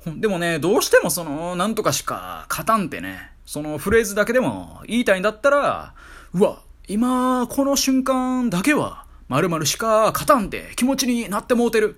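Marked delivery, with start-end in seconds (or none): 6.3-12.0 mock gushing. Acts out the wording they should use.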